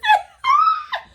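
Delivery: loud, very high shrieking laugh that nearly cries. Real, not acted. Storyteller losing it over her own story, shrieking laugh.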